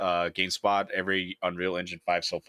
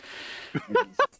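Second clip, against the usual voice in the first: high squeaky giggle fit; hiccup giggles. Real, not acted. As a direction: giggle in sharp hiccuping bursts. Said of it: "I can't stop laughing".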